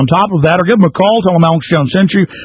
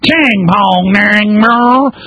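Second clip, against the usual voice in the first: high, twanging Kentucky gibberish; hammed-up Kentucky caricature, pitched up; mock Hillary Clinton Kentucky accent; 6 semitones higher, much slower than usual. Host parodying the clip just played, mock Kentucky accent. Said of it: "local dialect in Kentucky".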